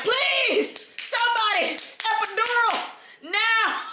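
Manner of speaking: high, loud pleading cry for help; desperate begging. Retold or imitated. Woman in labor begging staff for the epidural, loud and desperate. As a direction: beg the nurses like the pain is unbearable.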